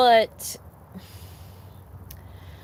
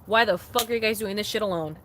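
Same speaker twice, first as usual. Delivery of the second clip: ordinary talk, nothing marked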